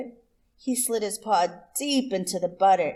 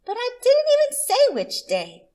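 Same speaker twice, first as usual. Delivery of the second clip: much higher, louder squeaky protest; indignant possum; 7 dB louder than usual. Acted. Miss Possum to Rabbit, high and indignant. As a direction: catch the liar out in a high indignant voice.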